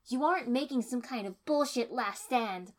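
loud, high furious voice. A narrator's reading. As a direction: rage at him. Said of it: "said furiously".

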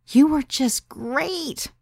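high, drawn-out gushing praise; enthusiastic. A narrator's reading. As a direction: gush with delight, stretching the words. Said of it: "Sarah said enthusiastically".

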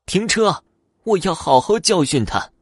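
high, loud angry command; loud yell. A narrator's reading. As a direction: yell out for the vehicle to stop. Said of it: "老太太大声地叫道".

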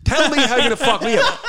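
high, loud dare through laughter; mock challenge up high and loud; laughing taunt. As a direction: goad him, half laughing. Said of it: "I go".

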